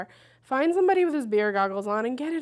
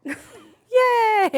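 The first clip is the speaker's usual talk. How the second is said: high, loud squeal of joy; a shrieking "Yay!" through laughter; gleeful cheer; 11 semitones higher, 9 dB louder than usual. Real, not acted. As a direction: cheer it out, delighted. Cheers about eating freely.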